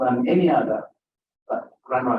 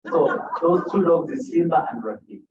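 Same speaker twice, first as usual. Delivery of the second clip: usual speaking voice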